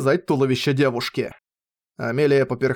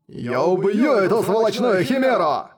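higher, frenzied raging yell; furious roar; 6 semitones higher than usual. Acted. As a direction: rave it out madly. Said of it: "бешено проорал Дюгалд".

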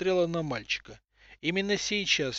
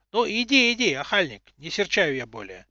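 put-on old woman voice; 3 semitones higher, 5 dB louder than usual. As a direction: call out like a grumbling grandma. Old woman calling boy back.

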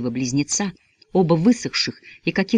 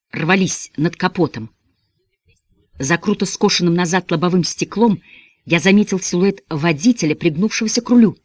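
usual speaking voice

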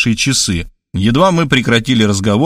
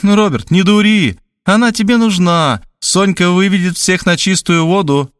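higher, pushy matchmaker; Tamara's voice; 7 semitones higher than usual. A narrator's reading. Tamara on the phone pushing the match, insistently. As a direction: sell the woman to him.

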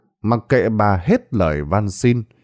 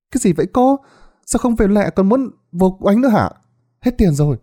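voiced young woman; 9 semitones higher, faster than usual. Thảo grumbles at Bà Sáu.

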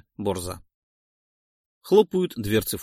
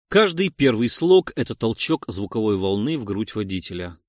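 ordinary delivery

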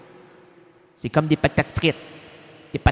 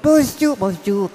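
high and louder cartoon hello, settling at the end; silly greeting voice; 4 semitones higher, 7 dB louder than usual. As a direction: mimic a goofy guy saying hi. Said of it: "puis il fait".

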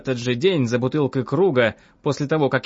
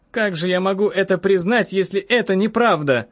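high-pitched indignant protest; indignant woman patient, voice lifted; 6 semitones higher than usual. A narrator's reading. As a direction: object hotly, pitch raised. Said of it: "возмутилась я".

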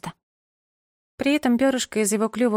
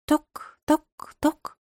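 tapping sound; 7 semitones higher, much slower than usual. A narrator's reading. Pigeon tapping its beak.

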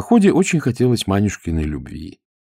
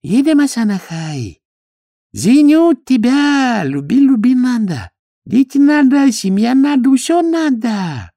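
high, slow, pleading broken Russian; lovestruck Chinese suitor; 5 dB louder, slower than usual. A narrator's reading. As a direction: beg her to come to Shanghai. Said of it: "влюблённо глядя на предмет обожания".

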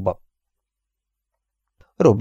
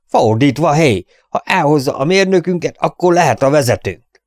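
heckling shout, pitched higher; 6 semitones higher, 4 dB louder than usual. A narrator's reading. Freckled man shouts down Robin in the saloon, loud. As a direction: yell the interruption across the room. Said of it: "ordított közbe".

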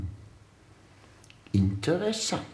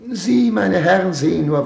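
raised voice; 4 semitones higher, 6 dB louder than usual. Acted. Excellency lectures his ministers.

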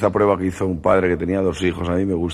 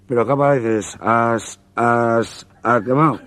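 stammering over has, pitched up; put-on stutterer, higher; stutterer's voice; 4 semitones higher, slower than usual. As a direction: stumble on each has before quemado. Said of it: "el tartamudo".

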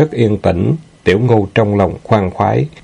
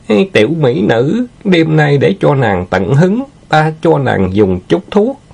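high, teasing leer; lecherous villain, gleeful; 7 semitones higher than usual. Acted. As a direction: dangle a treat at a captive in a high, sly voice.

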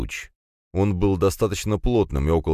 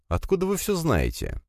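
surprised; 11 semitones higher, 3 dB quieter than usual. A narrator's reading. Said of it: "удивился я".

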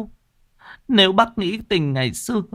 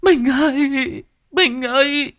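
high, slow wail for the dead; slower than usual. A narrator's reading. Widow wailing for her murdered husband, high and slow.

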